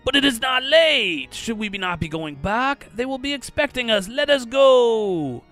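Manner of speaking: theatrical desperate appeal; acted pleading. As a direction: beg to be let go, voice climbing. Fortunato pleads to leave.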